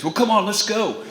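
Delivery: put-on president voice, high; mock president. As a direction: pitch it up as the president. Imitating the president.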